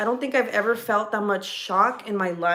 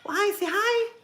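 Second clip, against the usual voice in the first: high-pitched mommy voice; 11 semitones higher than usual. Real, not acted. Mom prompting her child to greet viewers, high and sing-song. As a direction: lift it way up to coax a little one to say hi.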